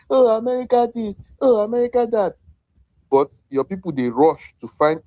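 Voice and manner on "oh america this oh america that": high, sing-song mimicry of critics; mocking quote. Mocking Pan-Africanists who complain about America, high and scornful. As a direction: parrot the critics in a higher, scornful voice. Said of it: "you criticize America".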